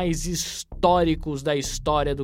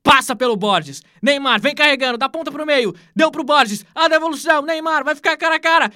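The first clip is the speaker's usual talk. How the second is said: building from calm to a shouted goal; explosive goal commentary; 8 semitones higher, 7 dB louder than usual. Acted. Building call of Neymar's goal.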